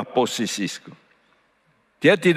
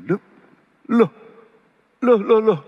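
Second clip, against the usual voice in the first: dying man's alarm; 10 semitones higher, 3 dB louder than usual. Retold or imitated. Imitating the dying man.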